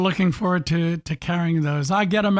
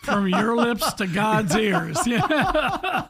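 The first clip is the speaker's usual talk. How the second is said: slow, laughing aside; host laughing at the dream consulting fee; laughing wish; 3 semitones higher, much slower than usual. Real, not acted. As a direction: chuckle it out slowly.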